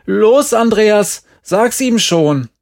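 high, slow, loud shout; drawn-out urging yell; very loud call. A narrator's reading. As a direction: shout it so others outside hear. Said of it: "Dann rief er ganz laut".

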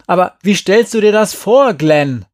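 high, loud challenge; indignant shout. A narrator's reading. Girl challenges her friend's objection, indignant shout. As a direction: fire the question back at him. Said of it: "rief Jerry".

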